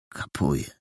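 quiet, grudging yes; reluctant. A narrator's reading. Perełka concedes to his friend, reluctantly and softly. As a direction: give in unwillingly. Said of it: "Ustąpił niechętnie Perełka".

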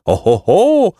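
high "oho" of astonishment; hammed-up storybook amazement; amazed knights. A narrator's reading. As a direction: exclaim in rising wonder. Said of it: "zdumieli się panowie i rycerze".

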